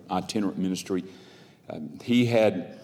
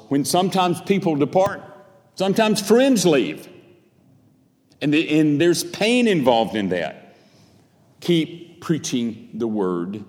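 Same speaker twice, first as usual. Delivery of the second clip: raised, ringing pulpit exhortation; impassioned preaching; 6 semitones higher, 5 dB louder than usual. Real, not acted. Preacher urging the congregation to endure trials, impassioned preaching. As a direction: preach it high, then measured and insistent. Preaches encouragement with rising force.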